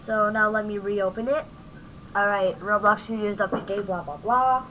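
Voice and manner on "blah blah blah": ordinary delivery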